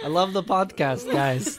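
high, loud put-on New Zealand accent, laughing; mock New Zealand accent. Teasing the hosts as a Kiwi fan, hammed-up New Zealand accent. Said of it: "that accent out".

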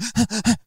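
sharp gasp, showing panicked panting. Shown as a demonstration. Dad showing how his daughter panted before crying, gasping. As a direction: demo her panicky breathing. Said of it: "panting sort of breathing".

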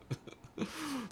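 short airy laugh up high; a quick giggle; high light laugh. Real, not acted. Laugh heard.

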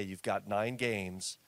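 ordinary delivery

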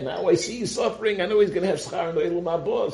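high-pitched, eager retold reasoning; animated realization. Rabbi Akiva explains to the weeping rabbis why he laughs, animated. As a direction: voice climbs high to make the happy point.

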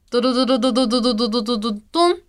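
fast drumroll chant; mock drumroll. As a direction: rattle off a quick drumroll. Drumroll before answer reveal. (早口のドラムロール)

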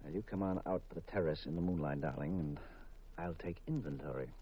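low soft flirtatious voice; low romantic. Acted. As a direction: murmur it as a seduction. Husband woos wife.

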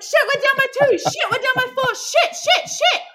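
high, loud panicking swearing; panicked inner voice. Retold or imitated. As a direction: spiral into high-pitched panic. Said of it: "That was literally my mind".

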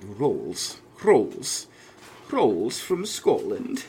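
put-on slow, rolled accent on "Rolls"; mock rolling accent. Host trying out how accents roll, put-on rolling accent. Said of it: "I think Welsh accents roll, roll".

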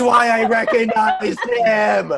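loud, drawn-out cry of recognition; excited realization. Real, not acted. Podcast host finally places a familiar actor, excited realization. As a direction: burst out with it, slow and loud.

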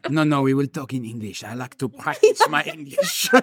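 mock-polite French villain accent. Frenchman wanting to practice his English, French accent. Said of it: "Another great French, like, authentic".

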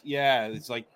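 normal talking voice

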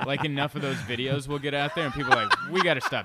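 cracking up at his own joke; real laughter while describing crying audiences; laughing. Real, not acted.

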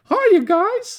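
very high, squeaky cartoon voice; old cartoon mouse squeak; Mickey Mouse voice. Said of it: "nineteen thirties Mickey Mouse cartoonish".

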